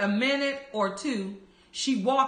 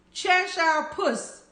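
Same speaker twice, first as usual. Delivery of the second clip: rather timid, higher-pitched and slow; a tentative, drawn-out call in a higher pitch; mildly shy opening address; 7 semitones higher, slower than usual. A narrator's reading. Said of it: "she began rather timidly".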